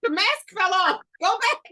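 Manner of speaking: loud, high-pitched playful alarm; hammed-up scramble to get the mask back on; mock panic. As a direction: cry out in comic alarm at being exposed. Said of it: "I be like".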